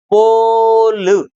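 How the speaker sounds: "पोल" with a very long, held O; long O demo. Shown as a demonstration. Teacher shows students the O sound, "पोल" drawn out. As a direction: stretch "पोल" out slowly on the vowel. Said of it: "यहाँ हम ओ को ओ बोलेंगे".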